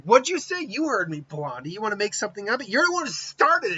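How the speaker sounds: high, indignant yell; angry shout. Acted. Naruto yelling back at Taiyo's insult, outraged.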